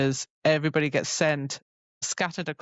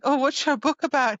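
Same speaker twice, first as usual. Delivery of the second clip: high, casual small talk; pitched up innocent question; mock casual question; 8 semitones higher than usual. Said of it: "he's like".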